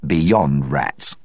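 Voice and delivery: each word Beyond rats spoken crisply and clearly; a dry distinct Beyond rats; distinctly. A narrator's reading. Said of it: "Clovis very distinctly said".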